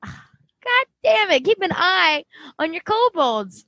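high, drawn-out, sighing complaint; acted exasperation. Exasperated warning to the kobolds' owner, acted high and slow.

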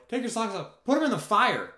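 high, loud call; new catchphrase yell. Retold or imitated. Shouting at campers over the fire, high and loud. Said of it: "I don't really know how it changed".

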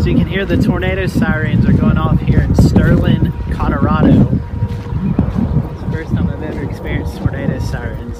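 loud voice over blaring sirens; shouting over sirens. Real, not acted. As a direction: raise your voice over the noise. Narrating over loud storm sirens.

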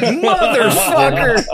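slow, high and loud drawn-out curse; a panicked slow yell at the screen; mock exclamation. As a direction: stretch the curse out, shrill with alarm. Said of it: "And I was like".

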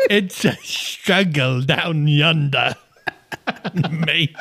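slow, drawn-out Aussie twang; mock Australian accent. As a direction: joke in a broad Aussie accent. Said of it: "Best Australian accent?".

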